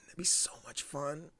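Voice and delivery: hushed, voiceless gushing; excited whisper. Real, not acted. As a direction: whisper it in awe at the idea. Whispered excitement at board game idea.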